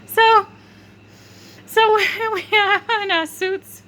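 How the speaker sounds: high, loud, squealy laughing talk; helpless giggling. Real, not acted. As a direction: squeeze the words out through laughter. Giggling fit mid-story.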